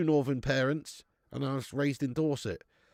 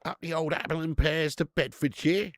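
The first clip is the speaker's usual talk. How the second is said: put-on Cockney accent; 3 semitones higher than usual. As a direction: play up a mock Cockney with rhyming slang. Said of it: "You sound Cockney".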